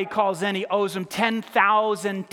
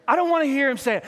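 ordinary delivery

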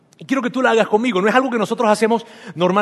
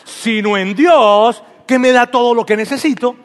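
louder, slow "sino en Dios" with a rising end; obvious tone; 5 dB louder than usual. Shown as a demonstration. Pastor repeats the declaration so the church catches the obvious tone. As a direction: declare it like it's self-evident. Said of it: "para que agarren el tono".

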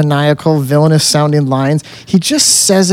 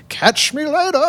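mock villain; 10 semitones higher, 5 dB quieter than usual. Quoting the villain.

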